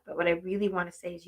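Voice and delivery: ordinary delivery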